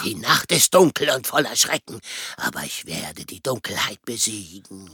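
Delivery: tiefe, langsame Heldenstimme; Batman-Stimme. Pretend. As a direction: sprich wie ein düsterer Superheld. [name] spielt Batman nach. (tiefe Batman-Stimme)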